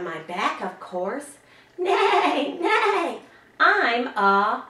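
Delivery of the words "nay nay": high whinnying neigh; whinny up high like a pony; mock horse neigh. To the child, a horse riddle clue, high playful neigh. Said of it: "animal riddles that you might like to do with me".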